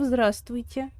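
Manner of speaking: ordinary delivery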